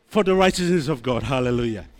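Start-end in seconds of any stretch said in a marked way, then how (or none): none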